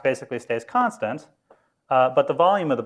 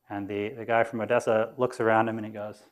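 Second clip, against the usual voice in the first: ordinary talk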